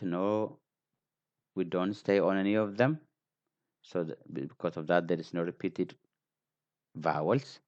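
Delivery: ordinary talk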